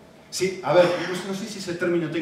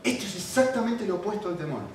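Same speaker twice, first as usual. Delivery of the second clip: ordinary talk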